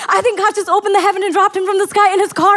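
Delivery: put-on high eager kid's wonder; a child sure of a miracle; young son in the car, excited. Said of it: "We're so excited".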